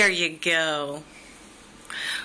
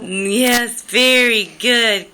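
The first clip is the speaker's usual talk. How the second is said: excited praise; 5 semitones higher, 6 dB louder than usual. Real, not acted. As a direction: cheer the little one on. Praising toddler's answer.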